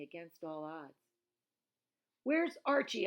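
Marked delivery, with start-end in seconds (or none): 2.2-3.1 high, loud urgent shout. A narrator's reading. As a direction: yell the question at her in a panic. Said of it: "I shouted at Granny".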